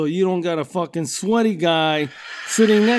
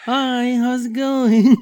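put-on higher, slow sing-song kid hello; cheery toddler greeting; 6 semitones higher, 3 dB louder, slower than usual. Small kid greeting people on the cinema stairs, sing-song. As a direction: greet strangers like a happy toddler. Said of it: "saying hello to people".

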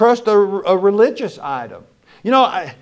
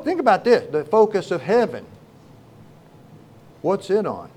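usual speaking voice

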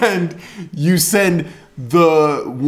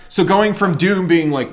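usual speaking voice

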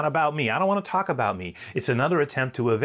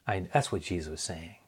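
normal talking voice

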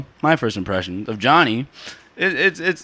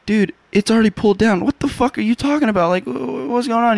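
raised-pitch retold bafflement; mock incredulous; 9 semitones higher than usual. Friends answering his stupid projector question, mock incredulous. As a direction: act out being baffled by a dumb question.

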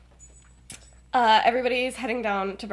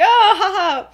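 fake laugh; 8 semitones higher, 7 dB louder, slower than usual. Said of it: "way too interested".

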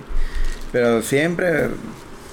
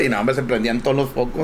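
ordinary delivery

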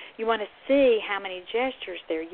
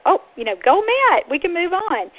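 high, loud burst of relief; excited cheer; 10 semitones higher, 8 dB louder than usual. Acted. Therapist cheering a child who has mastered the level, excited.

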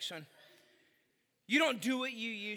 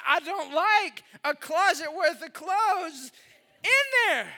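exasperated rant; 6 semitones higher, 5 dB louder, slower than usual. Retold or imitated. Venting about messy van.

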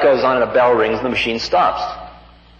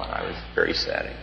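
whispered; 10 dB quieter than usual. Real, not acted. Recalling deep disappointment.

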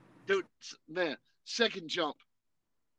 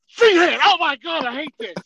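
loud, high exclamation easing off; shouted complaint after a hard landing; retold outcry; 8 semitones higher, 10 dB louder than usual. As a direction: cry out in pained dismay, hammed up. Acts out reaction to bad landing.

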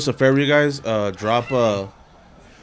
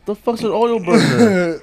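baffled; 9 semitones higher, slower than usual. Real, not acted. Baffled reaction to oil burner.

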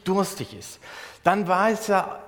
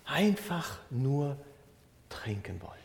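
fading whisper; 3 semitones lower, 6 dB quieter than usual. Real, not acted. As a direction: let the question die away to a breath. Rhetorical build about thirst. (langsam ins Flüstern verklingend)

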